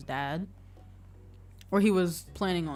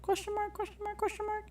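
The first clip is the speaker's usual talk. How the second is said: much higher, playful questioning voice; mock questioning; 6 dB quieter than usual. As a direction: pile on the doubt in a high joking tone. Joking doubt about her moms.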